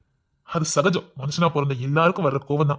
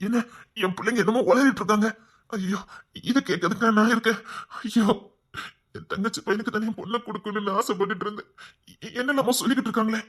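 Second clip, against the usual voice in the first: flustered mother; 5 semitones higher than usual. Acted. Mother fretting over children's chatter.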